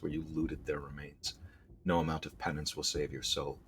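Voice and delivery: low, hushed storyteller voice; grave scene-setting for a fantasy game; story narration. A narrator's reading. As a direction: read it low and soft, like a dark tale. Reads the game's story aloud.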